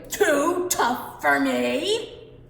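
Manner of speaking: very slow, loud scoffing shout; indignant giant shout. Acted. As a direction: stretch it out, offended pride. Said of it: "shouted Cucullin".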